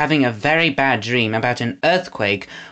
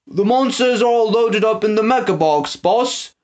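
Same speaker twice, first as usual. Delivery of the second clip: higher, eager henchman voice; henchman calling out; 8 semitones higher than usual. A narrator's reading. Trout reports to Snatcher, calling out eagerly. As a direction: report to the boss. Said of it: "called Trout".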